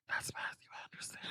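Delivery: hushed whisper, barely voiced. Johnny whispering who the man is. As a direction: whisper it like a secret at a party. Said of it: "And then Johnny is like".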